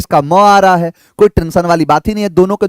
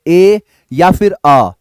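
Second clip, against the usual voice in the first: normal talking voice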